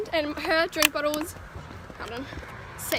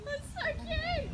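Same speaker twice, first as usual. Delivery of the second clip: high, slow, soft coo; a higher, softer, slowed coo; 10 semitones higher, 7 dB quieter, slower than usual. Real, not acted. Cooing over the toddler.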